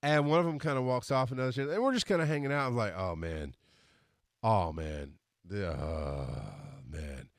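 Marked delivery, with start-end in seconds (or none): 2.9-7.3 low, slow-building sick moan, trailing off; queasy drunken groan. Retold or imitated. Drunk man alone after the show, queasy low groaning.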